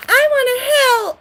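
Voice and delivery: high, loud fake-sweet voice; mock sweetness. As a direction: mimic a fake helper sucking up. Mocking power-hungry helper.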